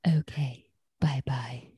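soft whispered bye-bye; whispered goodbye. Retold or imitated. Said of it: "the way she says bye".

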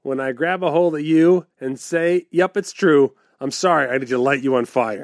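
sing-song limerick recital; bouncy limerick lilt. Pretend. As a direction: bounce through the rhyme. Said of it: "would like a limerick".

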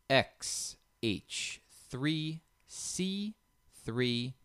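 drawn-out code read-out; code dictation. Real, not acted. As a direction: spell it out painfully slowly, pausing between each. Reads out a code.

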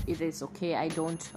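normal talking voice

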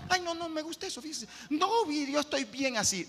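whiny mock complaint. Mocking comfortable churchgoer.

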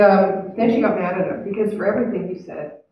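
ordinary talk

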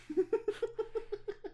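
sharp high laugh. Real, not acted. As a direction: laugh at your own joke. Laughing at own joke.

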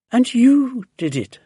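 high, loud praise; delighted cry. A narrator's reading. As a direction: exclaim it in admiration. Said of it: "Missus Silver cried".

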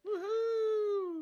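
high, bright cheer; excited whoop. Real, not acted. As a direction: whoop for a friend. Greeting a viewer in chat.

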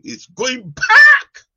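long high preacher cry. Real, not acted. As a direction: stretch it out in a loud wail. Preacher cries out.

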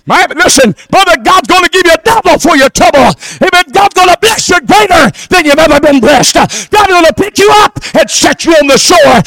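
shouted, high sermon climax; full-force preaching. Acted. Preacher shouting blessings over the congregation at full force. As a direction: preach it hard and high to the crowd.

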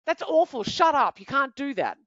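high, loud mock scold of a child. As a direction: snap at the kid like an impatient parent. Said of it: "we're not gonna scold them".

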